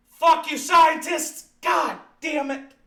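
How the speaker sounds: loud, high, drawn-out yell; hammed-up fury at the scientists; mock outraged shout.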